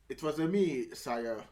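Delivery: mock Italian accent slipping into British; hammed-up Italian with a British tail. Mocking the actor's Italian accent slipping into British. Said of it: "since he's British".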